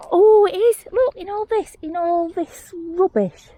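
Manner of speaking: high, excited squeal of delight; high-pitched wonder, stretching out the last words; thrilled discovery. Real, not acted. Finder spots a paint pan in rubbish, thrilled.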